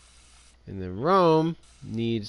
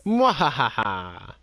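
mad scientist laugh; much slower than usual. Pretend. Villain laugh at Rome.

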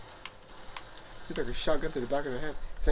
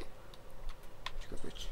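whispered taunt; 13 dB quieter, slower than usual. Pretend. Hunting invisible enemy.

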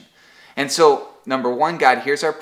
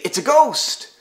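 a high, hammed-up cry of fright; mock terror; 10 semitones higher, 4 dB louder than usual. Disciples seeing Jesus on the water, mock frightened cry. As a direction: voice the panicked disciples, lightly. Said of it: "and they're terrified".

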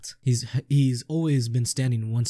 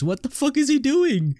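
much higher, louder burst of disbelief; real stunned outburst at the game; shocked disbelief; 4 dB louder than usual. Real, not acted. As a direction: yell at the screen in disbelief. Madahachi runs from his friend.

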